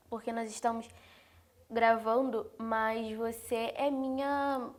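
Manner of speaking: usual speaking voice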